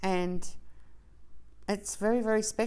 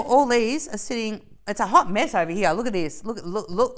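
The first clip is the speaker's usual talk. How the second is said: usual speaking voice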